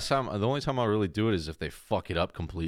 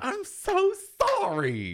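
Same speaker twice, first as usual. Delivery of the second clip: very high, very slow apology; mock gushing barista; much slower than usual. Barista apologizing again, very high and drawn out. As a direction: coo it out like an overeager barista. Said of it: "And he's like".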